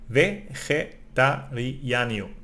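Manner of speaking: vegetariano drawn out syllable by syllable, slowly; slow demo. Shown as a demonstration. Teacher sounding out vegetariano slowly for learners. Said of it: "The first time I also did a little bit wrong".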